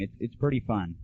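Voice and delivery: ordinary talk, nothing marked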